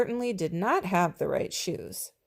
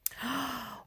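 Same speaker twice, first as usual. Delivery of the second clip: soft amazed gasp; 4 semitones higher, 10 dB quieter than usual. Acted. Teacher amazed.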